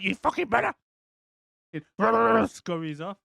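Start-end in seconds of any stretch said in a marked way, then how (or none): 0.0-0.7 higher put-on raptor snarl; raptor threat. Blue the raptor warning Owen, high mock snarl. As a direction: threaten him like a sulking raptor.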